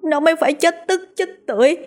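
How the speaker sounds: tearful reproach. A narrator's reading. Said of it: "mà trách móc".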